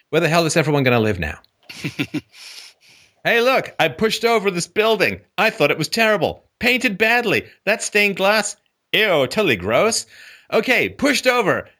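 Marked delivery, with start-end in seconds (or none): 3.2-11.7 high, gleeful and dismissive; a sneering wrecker, pitched high; smug demolisher. Acted. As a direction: brag about knocking it down. Mocking atheists toppling the church.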